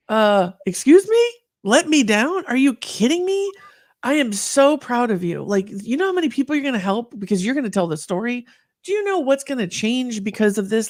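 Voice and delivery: mock outraged "let me down?", climbing high; incredulous friend. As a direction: friend refusing to accept an apology, incredulous. Friend's indignant reply retold.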